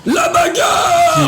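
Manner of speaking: high shouted battle cry, drawn out. Pretend. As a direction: shout it slowly like a brawler.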